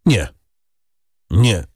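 choked, halting refusal. A narrator's reading. A stricken prosecutor refusing proof before the court, choked out. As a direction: force out each short word. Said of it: "wykrztusił".

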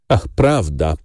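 plain reading voice

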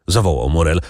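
plain reading voice

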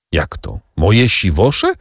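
ordinary delivery

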